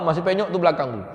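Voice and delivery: ordinary talk, nothing marked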